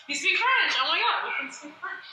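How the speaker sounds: delighted, pitch jumping high; thrilled to find a fellow French speaker; bright squeal of real delight; excited surprise. Real, not acted.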